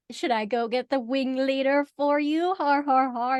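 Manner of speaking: loud, teasing mock offer with fake laugh; sarcastic dragon. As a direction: needle them loudly, playing the dragon. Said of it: "and he's like".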